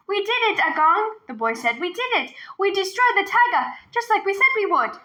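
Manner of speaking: put-on high, proud little boy's voice. A narrator's reading. Boy telling grandfather how they beat the tiger, high and proud. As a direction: boast to grandpa.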